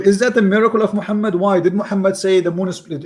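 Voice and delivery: normal talking voice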